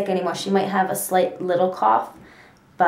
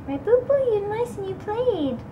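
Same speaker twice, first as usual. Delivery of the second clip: high sweet pet voice; 13 semitones higher than usual. Real, not acted. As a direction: coo at the cat. Talking sweetly to cat.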